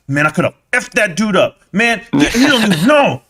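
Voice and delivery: hammed-up angry muttering in the car; mock car rant. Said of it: "screaming and pounding the wheel".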